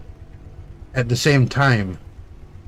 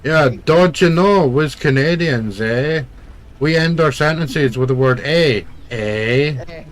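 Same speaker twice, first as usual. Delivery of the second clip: mock Canadian accent; 3 semitones higher than usual. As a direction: ham up a Canadian "eh". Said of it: "I can't really do a legit".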